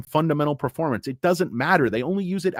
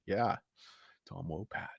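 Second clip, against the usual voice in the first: much softer, lower mumble; mock deadpan; 8 semitones lower, 14 dB quieter than usual. Streamer voicing the unimpressed reply, much softer. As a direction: mumble it back flatly under the breath. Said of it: "He's like".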